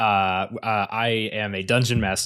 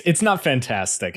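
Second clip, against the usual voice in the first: ordinary talk, nothing marked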